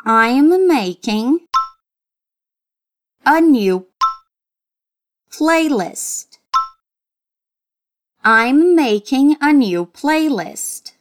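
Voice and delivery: slow, clearly articulated model sentence; each word laid out for the learner to echo; slow teacher repetition; slow drill. Shown as a demonstration. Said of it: "Let's repeat".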